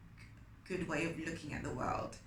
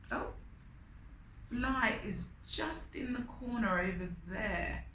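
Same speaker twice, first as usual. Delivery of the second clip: ordinary delivery, nothing marked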